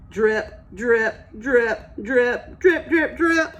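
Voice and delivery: put-on playful drip-drip-drip for the kids; dripping sounds. Acting out dripping faucet.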